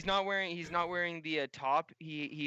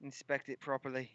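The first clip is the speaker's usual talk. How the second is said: lightly voiced Irish admirer; a hint of brogue, admiring; Irish gunsmith. Gunsmith admires customer's weapon, light Irish voice.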